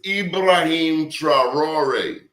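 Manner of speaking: Ibrahim Traore said slowly and deliberately, each syllable clear; slow careful name. Shown as a demonstration. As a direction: spell out Ibrahim Traore slowly, half speed. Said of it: "Once I tell you his name".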